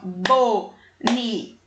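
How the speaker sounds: "Bonitinha" split slowly into bo-ni-ti-nha, one mouth opening per syllable. Shown as a demonstration. Teacher showing pupils how often the mouth opens, slow syllables. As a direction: open the mouth wide on each syllable of "Bonitinha". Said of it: "Olha quantas vezes eu abri a minha boquinha".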